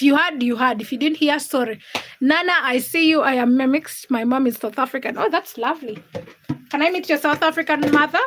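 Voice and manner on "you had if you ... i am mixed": usual speaking voice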